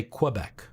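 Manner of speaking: Anglicized "Kwuh-bec" for Quebec; Quebec with a "kwuh" start. Shown as a demonstration. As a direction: say Quebec with a w after the k. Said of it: "because we say".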